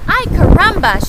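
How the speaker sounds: high, loud thrilled squawk of a chicken; excited cry. Acted. Chicken spotting the perfect nest, cries out loud and high. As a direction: shout it out with sheer delight. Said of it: "she cried".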